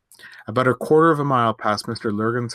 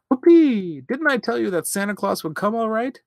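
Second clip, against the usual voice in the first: much higher, louder whoop of joy; delighted shout; 13 semitones higher, 4 dB louder than usual. A narrator's reading. Boy finds Christmas presents under the tree, delighted high shout. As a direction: whoop it out like a thrilled little boy. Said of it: "shouted the delighted Jimmy".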